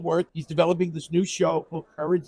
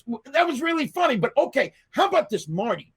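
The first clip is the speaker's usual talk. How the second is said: excited director; 8 semitones higher, faster than usual. Retold or imitated. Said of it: "And he goes".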